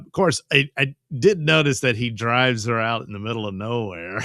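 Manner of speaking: ordinary talk, nothing marked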